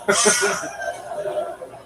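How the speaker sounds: shrieking laugh; high cackling laugh. Real, not acted. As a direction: burst out cackling. Laughing at his own story.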